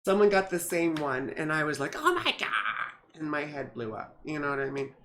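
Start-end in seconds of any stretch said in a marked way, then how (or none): 2.0-2.5 high-pitched mock gasp of shock; shocked oh my God. Retelling spotting the same tattoo, mock shocked. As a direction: squeal it like you just saw your twin.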